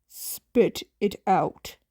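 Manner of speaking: low, slow impatient hero. Acted. Aizawa pressing the stalling teen, low and slow. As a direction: drag it out low and tired.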